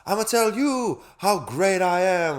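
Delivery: high, loud, slow boxer brag; Muhammad Ali boast. Retold or imitated. Imitating Ali's boast for his mate, loud and slow. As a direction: boast like a champion fighter. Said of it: "Muhammad Ali comes in".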